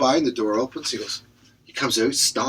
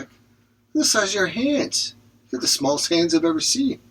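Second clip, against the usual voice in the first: high teasing voice; 6 semitones higher than usual. Acted. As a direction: tease him in a raised voice. Joke character teases guy.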